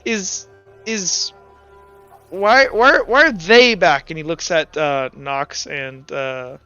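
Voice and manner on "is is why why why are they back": halting, stammering, rising in pitch; Felix. Acted. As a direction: start small, then build to a higher question. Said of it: "He goes, uh".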